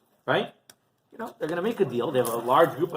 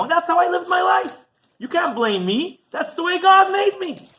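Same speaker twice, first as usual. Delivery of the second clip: defiant protest; 5 dB louder than usual. Retold or imitated. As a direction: insist loudly it is not his fault. Defiant argument to heavenly court.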